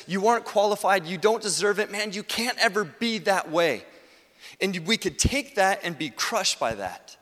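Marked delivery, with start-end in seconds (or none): 0.1-3.8 higher, louder string of accusations; accusing inner voice. Acted. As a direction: press each charge on the listener. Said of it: "There's a voice that says".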